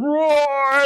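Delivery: high, loud playful roar; a hammed-up lion roar; mock lion roar. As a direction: burst out like the lion. Lion bursting out, told story.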